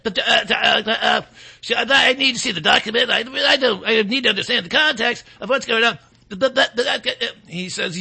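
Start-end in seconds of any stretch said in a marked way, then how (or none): 0.1-6.0 mock flustered, stuttering evasion; flustered stammering. Superintendent dodges the congressperson's question, mock stammering. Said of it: "she starts to start". 6.3-7.3 exaggerated stuttering false starts; mock stammering. Host mimics the superintendent failing to answer, mock stammering. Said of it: "she starts to start".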